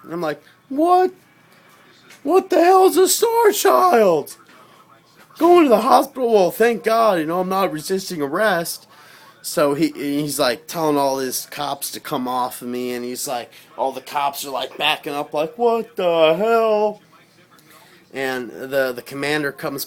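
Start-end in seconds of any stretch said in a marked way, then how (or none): 0.7-8.9 very high, loud disbelief; shocked outburst. Retold or imitated. Hearing he is a star child, very high shocked disbelief, then relief. 15.6-17.0 slow, high, loud bewildered what; drawn-out bafflement. Retold or imitated. Cops backing off puzzled, slow high bewildered.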